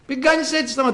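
high-pitched mock telling-off; scolding. Crowd trying to silence the shouting blind man, scolding. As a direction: scold him, pitched well up. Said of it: "Και τον μαλώνανε".

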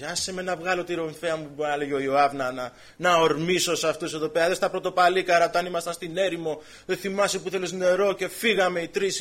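preacher drives home the prophet's task; fervent preaching. Acted. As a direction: press the point home to Jeremiah. Preacher voices Joab and the warriors.

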